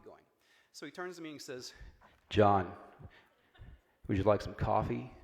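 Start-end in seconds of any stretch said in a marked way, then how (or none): 2.3-5.1 booming, much louder and lower; big booming voice. Retold or imitated. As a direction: boom it out so the floor shakes. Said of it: "In the big booming voice".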